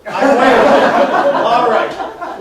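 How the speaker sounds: loud, high, drawn-out echo through laughter; laughing admission. Real, not acted. Echoes timekeeper amid audience laughter.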